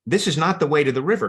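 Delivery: fast, high protest; alarmed cry. A narrator's reading. Mice protest Foxy G's route from fire, alarmed cry. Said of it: "cried the mice".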